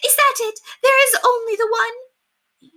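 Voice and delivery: very high, slow, loud disbelief; frantic. Acted. Lady frantically questions the single letter. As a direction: draw it out in shrill dismay.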